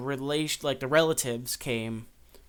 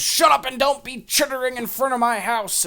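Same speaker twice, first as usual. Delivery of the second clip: put-on high, loud angry old-man yell; 12 semitones higher, 8 dB louder than usual. Old man yells at gossips outside his house, angry shouting. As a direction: shout them off your property. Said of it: "he's yelling at him".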